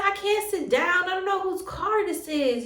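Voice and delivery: worked-up retold objection; upset protest. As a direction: protest with rising agitation. Retold refusal, getting upset.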